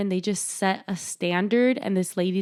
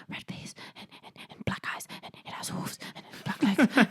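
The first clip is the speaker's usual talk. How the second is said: imitating a hushed, rapid whisper; 3 semitones higher, 5 dB quieter than usual. Medium whispers to Specs what she sees, whispering. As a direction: whisper it as if into someone's ear. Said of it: "like, whispers to him".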